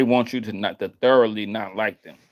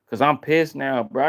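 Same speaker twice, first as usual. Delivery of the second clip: ordinary talk, nothing marked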